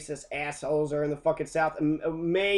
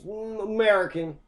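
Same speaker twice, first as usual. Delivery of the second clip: mock hillbilly Kentuckian; 5 semitones higher, 3 dB louder than usual. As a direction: ham up an offended country boy. Mock Kentuckian answers again.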